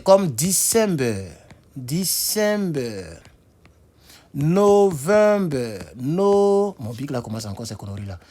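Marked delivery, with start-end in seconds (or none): 0.3-3.0 DECEMBER very slow, each syllable held long; word-ending demonstration. Shown as a demonstration. Teacher showing the -ber ending, slow drawn-out syllables. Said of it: "se terminent comme ça". 4.3-6.7 NOVEMBER loud, high and greatly stretched; word-ending demonstration. Shown as a demonstration. Teacher showing the -ber ending, loud drawn-out syllables. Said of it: "se terminent comme ça".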